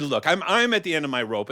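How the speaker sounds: high, pleading queen voice; a wife fretting over her husband; worried queen. Acted.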